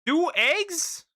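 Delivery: high squeaky disbelief; baffled. Real, not acted. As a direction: ask it in shrill confusion. Puzzled repeat of odd word.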